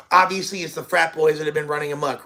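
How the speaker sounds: normal talking voice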